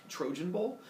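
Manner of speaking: usual speaking voice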